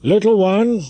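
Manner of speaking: high, loud call down to the square; a captain hailing the dancer below. Acted. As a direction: call her from the balcony. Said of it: "he began to call out".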